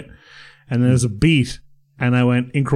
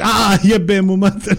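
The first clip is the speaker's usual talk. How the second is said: slow, higher hammed-up sick voice, laughing; mock sick groan; 6 semitones higher, 4 dB louder, slower than usual. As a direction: groan it out like you've just been sick, half laughing. Faking vomiting on bed.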